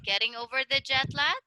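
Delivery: quick question, pitch rising sharply on "lag"; question intonation. Shown as a demonstration. Teacher correcting student that it's a question, rising intonation. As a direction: ask it with "lag" going up. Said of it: "It's a question".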